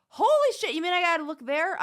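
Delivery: loud, high shocked outburst. Real, not acted. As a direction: blurt it out in alarm. Shocked reaction to toenail news.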